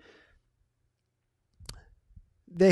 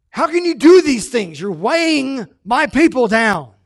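loud rebuke; 6 semitones higher, 9 dB louder than usual. Acted. Jesus rebukes the Pharisees.

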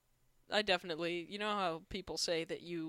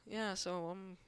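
normal talking voice

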